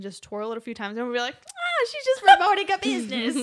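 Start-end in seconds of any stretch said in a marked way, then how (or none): 1.5-3.3 high, loud, laughing realization; mock cheery onlooker. Passers-by seeing a giant arrow sign, mock cheery. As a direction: call it out with carefree relief.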